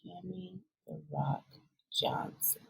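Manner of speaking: whispered, dramatic name reveal; hushed echo. Pretend. Cohost echoing the new nickname, in a hushed whisper.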